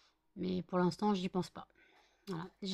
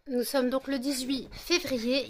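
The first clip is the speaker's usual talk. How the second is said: ordinary talk, nothing marked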